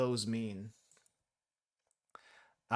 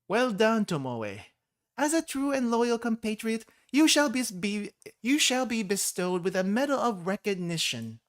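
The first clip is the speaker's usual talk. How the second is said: higher, louder grand dictator voice; pompous leader; 8 semitones higher, 5 dB louder than usual. Acted. Leader praising Tomoe with a medal, loud and grand. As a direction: bestow the medal like a tiny tyrant. Reads Chirino's dialogue.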